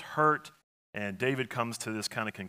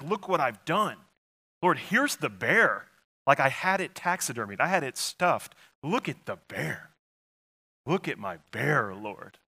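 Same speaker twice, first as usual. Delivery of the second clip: higher, louder proud swagger; boastful showing off; 3 semitones higher, 4 dB louder than usual. Pretend. Pastor mocking a proud believer's boast to God. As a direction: brag like a hunter showing off a trophy. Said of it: "The temptation is almost to say".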